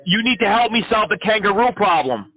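high, excited ranting crank; manic caller. Acted. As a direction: rant like an unhinged crank caller. Crank caller rants at scammer.

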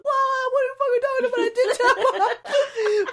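high-pitched, incredulous wail; outraged outburst. Acted. Rider protests to the teller about tapping off, high outraged wail.

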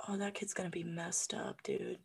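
whispered aside. Retold or imitated. Cashier whispering to herself about the poor kid. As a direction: breathe it out under your breath.

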